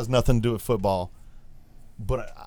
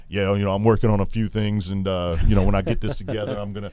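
low, firm, assured voice; steady, lower-pitched athlete giving an update; confident player; 5 semitones lower than usual. Acted. Host voicing the player he'd love to hear, confident and low. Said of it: "But he, he has this, like, soft kinda".

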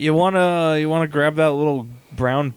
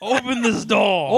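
cat voice; 6 semitones higher than usual. Acted. Acting out the cat demanding.

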